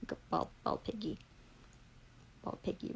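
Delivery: scornful whisper; whispered insult. Real, not acted. Describing the police officer, a scornful whispered insult. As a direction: hiss it under your breath like a private jab.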